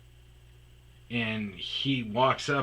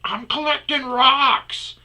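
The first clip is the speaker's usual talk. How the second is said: much higher and slower, daft; slow innocent excuse; 9 dB louder, slower than usual. Retold or imitated. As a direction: say it like a dopey liar. Man's excuse.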